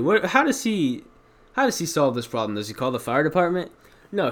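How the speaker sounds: normal talking voice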